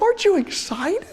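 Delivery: high-pitched, eager mock question; excited wife. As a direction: imitate a thrilled wife prodding her husband. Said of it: "She's so happy".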